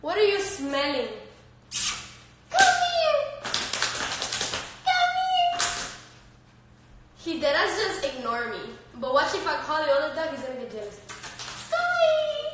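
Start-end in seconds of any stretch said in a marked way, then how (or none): 2.5-5.6 very high, squealed come-here to a dog; high-pitched pet call. Real, not acted. Calling the dog over. 11.7-12.5 very high little squeal to a dog; high-pitched pet talk. Real, not acted. Talking to the dogs.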